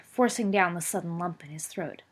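ordinary delivery, nothing marked

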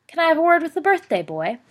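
loud high call across the room. Acted. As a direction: raise the voice to reach the boy. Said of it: "called a voice".

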